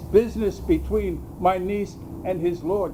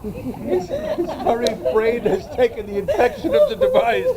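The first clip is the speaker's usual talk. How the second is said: laughing; 8 semitones higher than usual. Acted. Laughs mocking Malvolio.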